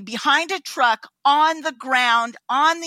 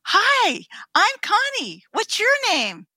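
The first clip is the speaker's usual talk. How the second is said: bright chirpy greeting; 9 semitones higher, slower than usual. Retold or imitated. Greeting homeless man cheerfully.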